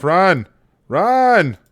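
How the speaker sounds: high desperate yells of run; frantic shouting. Real, not acted. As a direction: scream at him to run. Urging soldier to escape.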